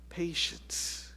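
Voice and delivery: whispered, soft word. Real, not acted. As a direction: breathe the word out gently. Key sermon word contrasted.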